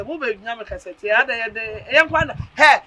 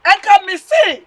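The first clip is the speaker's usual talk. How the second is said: outburst; 7 semitones higher, 11 dB louder, much slower than usual. Acted. Wife quarrels about husband drinking.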